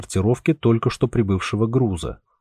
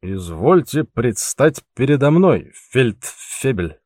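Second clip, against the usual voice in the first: put-on slow, slurred drunken command; mock officer's order, drunkenly; much slower than usual. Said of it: "пьяно выкрикивал Пауль".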